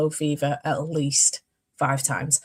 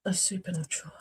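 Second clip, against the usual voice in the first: whisper; 11 dB quieter, faster than usual. Real, not acted. Muttering while typing a search.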